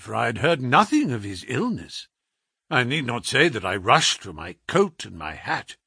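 usual speaking voice

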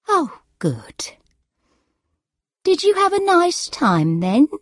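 mother's question lifted very high; confused elderly mother. A narrator's reading. Muddled mother asks daughter about a holiday, high-pitched.

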